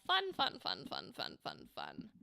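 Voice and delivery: fading out. Real, not acted. Host trailing off about the broken chat, fading to a whisper. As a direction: let the repeated fun die away into a whisper.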